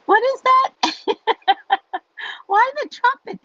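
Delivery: high, loud laughing disbelief; amused surprise. Real, not acted. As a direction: squeal at the silly question. Reacting to a comment, amused.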